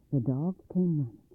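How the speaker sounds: usual speaking voice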